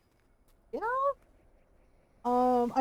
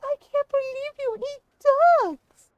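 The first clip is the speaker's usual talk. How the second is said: put-on upset little girl; 13 semitones higher, 7 dB louder than usual. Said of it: "She's like".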